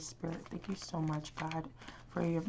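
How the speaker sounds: soft, hushed prayer to Spirit; quiet prayer. Real, not acted. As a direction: pray softly, asking for guidance.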